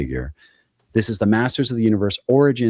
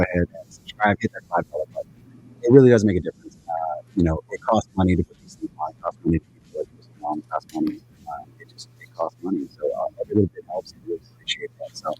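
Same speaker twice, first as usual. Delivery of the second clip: underwater; 3 semitones lower than usual. Real, not acted. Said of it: "I think you're underwater".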